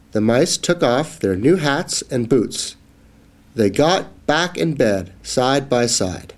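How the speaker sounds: usual speaking voice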